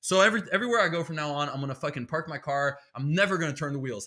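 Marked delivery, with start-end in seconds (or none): none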